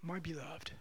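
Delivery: very soft, hushed and loving; almost a whisper of welcome; tender. Retold or imitated. God welcomes a repentant sinner: hushed, tender. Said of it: "his response will always be".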